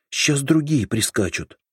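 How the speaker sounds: put-on high, urgent frightened girl. A narrator's reading. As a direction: blurt it out in a sudden fright. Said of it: "испуганно заговорила она".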